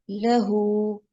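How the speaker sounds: लहू drawn out with a long final ू; slow recitation. Shown as a demonstration. Teacher reciting लहू slowly to show the long vowel. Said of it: "लहू इसको करके पढ़ेंगे".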